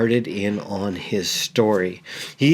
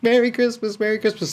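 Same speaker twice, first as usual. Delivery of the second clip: much higher, joyful yelling of the greeting; excited shouting; 11 semitones higher, faster than usual. Retold or imitated. Father shouting to his children from the wagon, high and joyful. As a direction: throw the words up high like an overjoyed dad. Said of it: "He's just".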